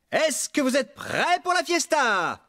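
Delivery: high, slow, showman's question; rallying cry. Acted. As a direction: pump up the crowd for the party. Max rallies the animals.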